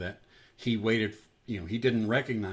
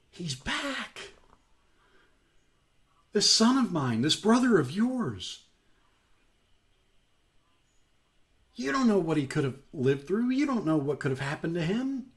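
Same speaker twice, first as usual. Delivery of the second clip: pleading father; 6 semitones higher than usual. Acted. Father pleads with older son.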